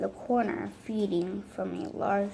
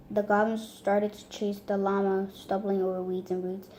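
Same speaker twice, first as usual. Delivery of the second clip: usual speaking voice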